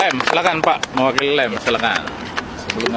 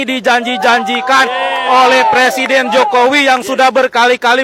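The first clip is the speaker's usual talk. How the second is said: fiery rally speech; 4 semitones higher than usual. Real, not acted. Host rallying crowd.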